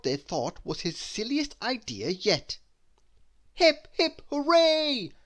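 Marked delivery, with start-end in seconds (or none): 3.5-5.1 crowd cheer. Acted. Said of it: "cried the crowd".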